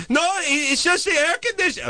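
shrill high calling voice, heavily put on; mocking the neighbor. Neighbor calling Adam in a squealing mock voice. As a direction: squeal his name like the pushy neighbor.

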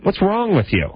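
high-pitched, incredulous confrontation; acting indignant wife. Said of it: "why did you say to your husband".

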